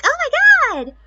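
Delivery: very high, loud hammed-up shriek; mock scream. Huddled girls at the mansion, loud mock scream. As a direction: scream it like a terrified schoolgirl. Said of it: "going like".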